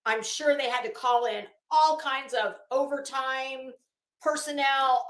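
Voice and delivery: ordinary delivery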